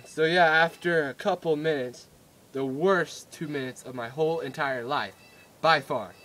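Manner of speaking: ordinary delivery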